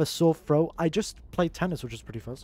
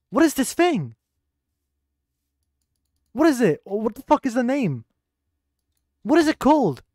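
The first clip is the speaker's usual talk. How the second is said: high, loud, incredulous outburst; exasperated bafflement; 6 semitones higher, 6 dB louder than usual. Real, not acted. Streamer who forgot what a tree is called, exasperated bafflement. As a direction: puzzle aloud with pitch pushed up.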